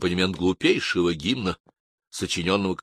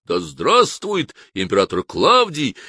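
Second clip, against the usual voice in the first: roaring cheer; 6 semitones higher, slower than usual. Acted. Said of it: "с криком".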